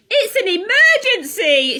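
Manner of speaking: high, loud urgent shout. Acted. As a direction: cry out the alarm, pitched high. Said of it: "shouted Ozzy".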